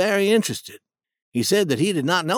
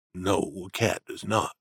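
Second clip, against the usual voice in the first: lower, slower voice for Jim; low steady Jim arguing his point; Jim's voice; 9 semitones lower, 6 dB quieter than usual. A narrator's reading. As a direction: voice the older man, grounded and sure. Jim answers.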